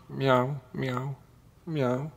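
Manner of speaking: high, soft little meows; soft cat cry. A narrator's reading. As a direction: mew quietly like a frightened kitten. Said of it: "just softly at first".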